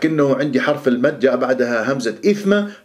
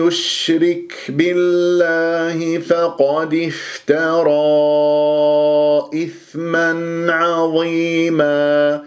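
slow, measured tajweed recitation; Quran recitation; 3 semitones higher, much slower than usual. Shown as a demonstration. Teacher reciting the verse for students, slow measured recitation. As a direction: hold the long vowels on a steady note.

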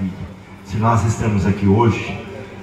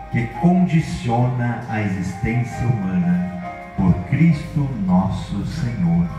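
prayer; 2 semitones higher, 4 dB quieter than usual. Acted. Leads a formal blessing prayer.

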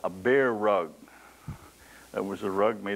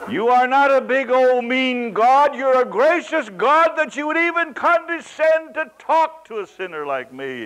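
fervent praise; 14 semitones higher, 10 dB louder than usual. Retold or imitated. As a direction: pour it out to God in joy. Believer's prayer to God.

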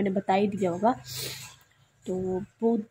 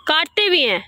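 high, loud call, falling at the end; 13 semitones higher, 6 dB louder than usual. Real, not acted. Calling out loudly outdoors.

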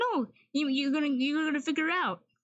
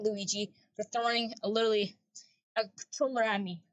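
ordinary talk

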